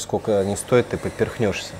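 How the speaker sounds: usual speaking voice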